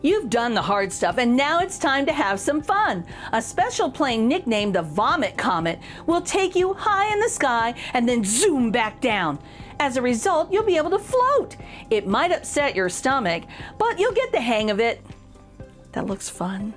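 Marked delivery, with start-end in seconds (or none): none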